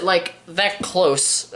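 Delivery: very slow, stretched-out words; slow demonstration. Shown as a demonstration. Reviewer slowly showing the tight scope shot, drawn out. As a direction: drag it out to show how close. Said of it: "I don't know why I'm doing it like this".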